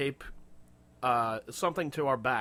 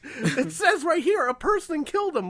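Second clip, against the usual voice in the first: laughing outrage; 13 semitones higher, 6 dB louder than usual. Retold or imitated. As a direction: squeak it out, cracking up.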